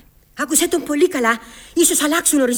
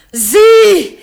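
defiant shout; 6 semitones higher, 10 dB louder than usual. Acted. Mother to her son, a loud defiant shout.

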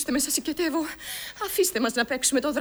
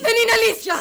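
much higher and louder, sobbing scream; crying shout; 10 semitones higher, 7 dB louder, faster than usual. Acted. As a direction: scream the denial through tears. Daughter denies again. (κραυγή με κλάματα)

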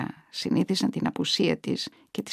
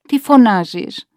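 loud, drawn-out irritated demand; exasperated scolding; 4 semitones higher, 11 dB louder, slower than usual. A narrator's reading. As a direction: scold an old mother for calling out. Relative scolds the calling mother. (εκνευρισμένη, δυνατή επίπληξη)

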